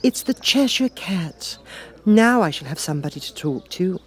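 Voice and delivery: put-on a young girl, pleased to spot the Cat; Alice voice. Alice greets the Cat with relief, girlish voice.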